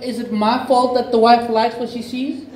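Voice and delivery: lightly hammed-up innocence; mock protest. Acts defending himself to the neighbour.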